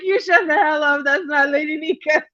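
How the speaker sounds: high, amused mock scolding; laughing retort. Telling off the fake Lady Nika, laughing and high.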